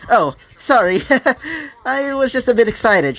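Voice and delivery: high, excited, sheepish voice; giggly apology. Acted. Nobunaga apologising after scaring friends, giggly and excited. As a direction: laugh lightly through the apology.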